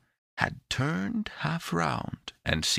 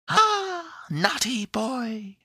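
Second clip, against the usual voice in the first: high, slow, teasing little voice; laughing tone; much slower than usual. A narrator's reading. The little voice in the box teases Epimetheus, in a laughing tone. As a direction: tease playfully in a tiny high voice. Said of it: "in a laughing tone".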